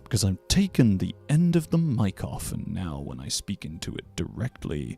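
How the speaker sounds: deep, slow voice close to mic; low and lingering, descending all the way down; full low vocal range. Shown as a demonstration. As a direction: sink to the bottom of your range. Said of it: "when I talk like this".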